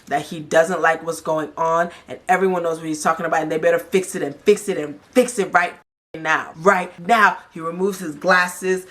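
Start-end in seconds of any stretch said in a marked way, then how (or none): none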